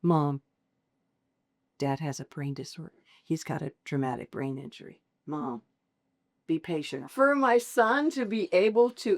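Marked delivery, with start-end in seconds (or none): 0.0-7.1 low, soft and patient retelling; quiet, imitating son. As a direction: gently hush it, as a son counselling his mother. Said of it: "quietly step over to me and say".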